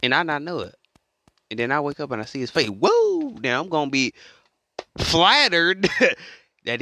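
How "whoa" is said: high, loud startled cry; acted astonishment. Imagining waking up to Jesus' face, astonished.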